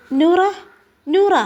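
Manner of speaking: calling out. Acted. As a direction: call her name to wake her. Sister calls Noora awake.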